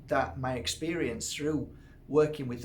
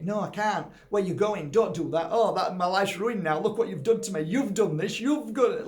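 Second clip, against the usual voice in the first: higher and louder, a put-on needy voice accusing him; whiny blaming dependant; 5 semitones higher, 6 dB louder than usual. Clingy client blaming the helper who pulled back, whiny and loud. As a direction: play the clingy one whining that he ruined their life. Acting out the clingy person's blame.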